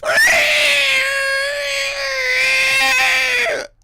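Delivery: long, very high, piercing monster screech; creature's shriek. Acted. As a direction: let out one loud, sustained lizard-beast cry. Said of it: "and it just goes".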